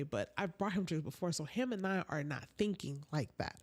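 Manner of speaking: ordinary talk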